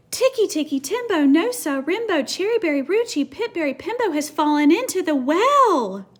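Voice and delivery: high, loud recited name; teacher leading kids in calling the name. Acted. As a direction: call it out for the kids to join. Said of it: "say it with me this time".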